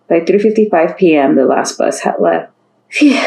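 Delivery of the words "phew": high relieved phew; relieved sigh. A narrator's reading. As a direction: blow out relief. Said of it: "sighed Casey".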